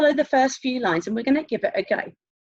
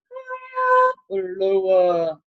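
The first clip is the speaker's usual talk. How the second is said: HIGHER held very high, LOWER dropped low; exaggerated slow pitch leap from HIGHER down to LOWER; high then low; 3 semitones lower, much slower than usual. Shown as a demonstration. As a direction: pipe HIGHER up top, sink on LOWER. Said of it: "whether we need to go".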